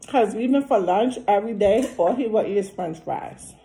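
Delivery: talking around food; chewing between words; mouth full chewing. Real, not acted. Seen 0: Bite taken at -1.0; lips pressed, jaw working while talking.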